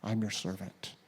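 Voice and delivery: a quiet, lowly second I'm your servant; humble soft repeat. Shown as a demonstration. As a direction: say it again, hushed and humble. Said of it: "David indicates his own humility".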